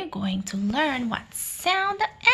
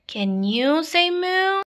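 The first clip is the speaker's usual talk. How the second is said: ordinary delivery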